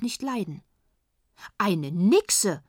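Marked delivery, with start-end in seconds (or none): 1.6-2.6 a louder, scandalised call; mild indignant exclamation. A narrator's reading. Frau Philipp exclaims at the uncle, a little louder. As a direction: exclaim it with a touch of outrage. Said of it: "rief Frau Philipp".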